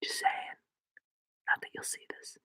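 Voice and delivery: secret whispered aside; hushed aside to the viewers. Real, not acted. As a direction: breathe it out unvoiced. Aside to the camera.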